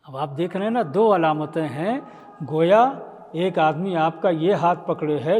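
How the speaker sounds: ordinary talk, nothing marked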